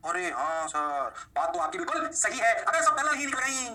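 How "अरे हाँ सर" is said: slow, lower pupil voice; student realisation. Acted. Students agreeing rays are parallel, slow student realisation. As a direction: draw it out as a pupil catching on.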